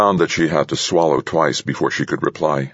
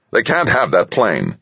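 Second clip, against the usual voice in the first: higher, forceful objection; snapping; 8 semitones higher than usual. A narrator's reading. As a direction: insist with irritation. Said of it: "the president snapped".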